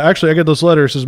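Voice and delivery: normal talking voice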